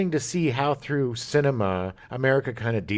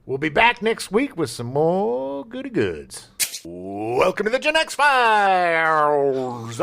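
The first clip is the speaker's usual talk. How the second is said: announcer voice; 6 semitones higher, 4 dB louder, slower than usual. Acted. Show sign-off and intro.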